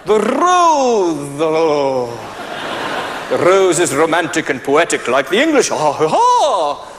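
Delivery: plummy English toff voice; posh English. Pretend. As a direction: play a pompous English gentleman at the counter. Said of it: "the English turn up first".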